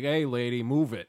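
loud, mock-tough order; mock confrontation. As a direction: pretend to shoo off a stranger, loudly. Imagined confrontation with a stranger.

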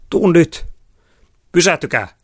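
high, loud calls in a chase; urgent shouting. A narrator's reading. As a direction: call out sharply and urgently. (kiireinen huuto)

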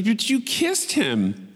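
high, drawn-out mock disbelief; Sue. Coworker reacting to the kiss, scandalized. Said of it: "She's like".

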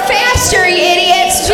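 high, impatient call; mock-scold the singers over the music; bossy heckle. Real, not acted. Comic hurrying audience through birthday song, high impatient call.

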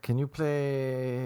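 slow, soft, drawn-out request; drunk requester. Acted. Drunk customer requesting a song from the DJ, slurred-out request. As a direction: trail the last word out endlessly.

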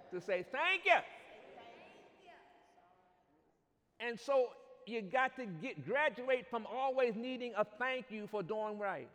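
preaching. Acted. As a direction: preach it with rising fire. Preacher building to full force.

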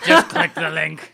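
2 slow, loud laughing plea. Acted. Laughing repeat of plug.

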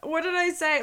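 normal talking voice

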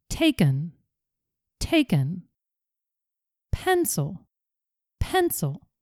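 each word twice with short weak schwa; practice drill. Shown as a demonstration. Teacher drilling learners on schwa words, calm. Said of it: "Let's practice saying all these words".

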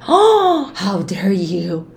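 gasp, then slow hammed-up how dare you; mock shock at a mother's self-care; mock outrage. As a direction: play a scandalized gasp.

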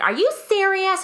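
high disbelieving mock question; imitating an annoyed passenger's disbelief; incredulous. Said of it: "this one girl's like".

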